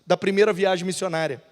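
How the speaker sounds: ordinary delivery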